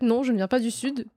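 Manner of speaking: ordinary delivery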